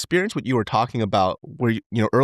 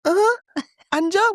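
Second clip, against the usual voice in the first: put-on much higher baby voice calling dad; toddler voice; 3 dB louder than usual. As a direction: imitate a little boy tugging at you. Imitating toddler son.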